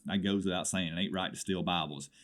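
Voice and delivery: ordinary delivery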